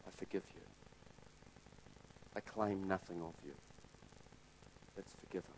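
soft merciful king; gentle forgiveness. Retold or imitated. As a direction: forgive him quietly, kindly.

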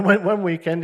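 ordinary talk

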